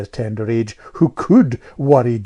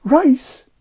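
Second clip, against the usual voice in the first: put-on high, louder piping girl; piping know-all child; 5 dB louder than usual. A narrator's reading. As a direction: pipe up like a little know-all. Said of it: "piped Amelia".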